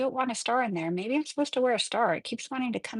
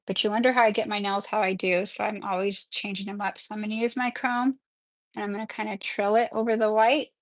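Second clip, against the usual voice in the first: ordinary delivery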